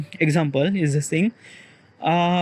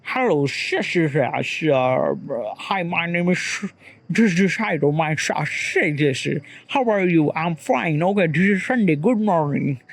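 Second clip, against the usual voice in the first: halting, slurred mock British accent; badly faked British accent with shushing stammers; attempted British accent; slower than usual. Shown as a demonstration. Speaker showing listeners how British English sounds, shaky British accent attempt. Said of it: "if you went to the British accent".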